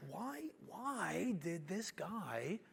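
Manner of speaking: slow, hushed wondering; puzzled whisper. Retold or imitated. Young contractor brooding over client's outburst, whispered puzzlement. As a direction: whisper it slowly, re-enacting bafflement.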